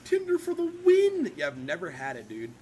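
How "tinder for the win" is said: much higher and louder rallying cry; mock cheer. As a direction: cheer it like an ad slogan.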